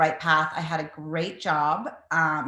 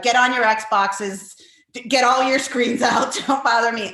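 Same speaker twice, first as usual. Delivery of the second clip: mock shooing the kids; 4 semitones higher, 5 dB louder than usual. Mother sending her kids to their screens so she can bake, a louder playful order. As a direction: shoo them off with a playful bark.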